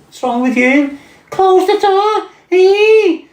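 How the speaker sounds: very high, shrill mock girlfriend squeal, stretched out; shrieking girlfriend. Imitating girlfriend shrieking at him.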